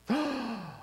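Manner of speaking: high, audible gasp; shocked gasp. Acted. Preacher reacts to news of the Ark being taken, shocked gasp.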